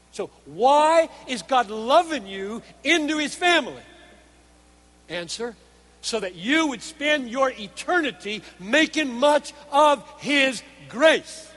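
loud, measured sermon delivery; emphatic preaching. Real, not acted. Preacher laying out God's design in loving us, emphatic preaching. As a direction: drive the point home word by word.

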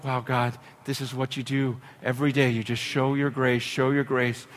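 hushed prayer. Real, not acted. Preacher speaking softly to God in awe. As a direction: murmur it to God, almost to yourself.

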